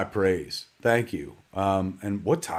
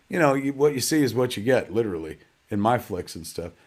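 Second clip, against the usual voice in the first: ordinary talk, nothing marked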